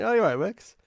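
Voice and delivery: retold eager greeting. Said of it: "saw him, went".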